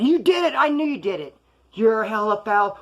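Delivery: high, indignant outburst; heated accusation. Retold or imitated. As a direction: accuse them at a high pitch. Acted phone accusation in quarrel.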